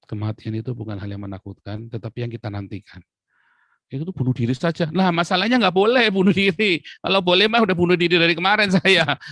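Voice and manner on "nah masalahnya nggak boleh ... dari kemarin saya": higher, louder comic outburst; animated preacher quip; joking punchline. Real, not acted. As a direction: land the joke with energy.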